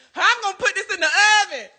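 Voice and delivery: high-pitched, quick wail; overwhelmed. Real, not acted. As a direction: wail high in helpless dismay. Overwhelmed by the huge alligator.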